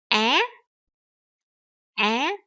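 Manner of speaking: AH swept sharply upward in pitch; rising tone. Shown as a demonstration. Teacher shows the next vowel, AH with a rising tone. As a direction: lift AH like a question. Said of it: "So that is the rising tones".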